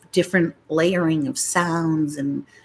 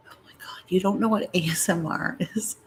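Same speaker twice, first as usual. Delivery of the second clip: whispering; 5 dB quieter than usual. Real, not acted. Whispering near sleeping child.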